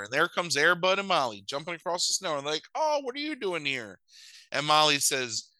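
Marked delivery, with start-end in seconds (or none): 2.7-4.0 high put-on greeting; mock happy surprise. Puppies see parents arrive, mock delighted surprise. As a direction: greet them in exaggerated surprise.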